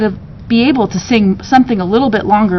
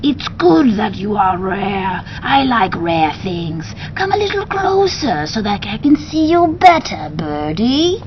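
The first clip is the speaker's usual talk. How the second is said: soft slithery beast; 3 dB quieter than usual. Acted. Beast commanding the parrot to sing, soft and slithery. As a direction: purr it like a snake made of feathers.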